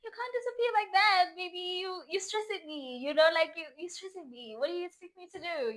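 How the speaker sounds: high-pitched lovey-dovey whining; hammed-up pouty complaint; whiny pleading. Acted pleading complaint to boyfriend.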